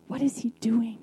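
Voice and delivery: hushed confused whisper; whispered aside among the disciples. Retold or imitated. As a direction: breathe it like a murmur in the room. Murmurs in the hushed room.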